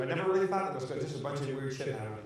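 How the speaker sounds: ordinary talk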